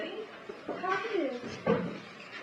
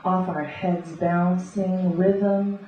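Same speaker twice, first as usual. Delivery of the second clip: rhythmic beat poetry reading; beatnik; 10 dB louder than usual. Acted. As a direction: read it like a coffeehouse beat poet. Spoken word poetry reading.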